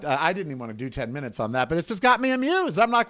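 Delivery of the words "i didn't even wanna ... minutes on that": ordinary talk, nothing marked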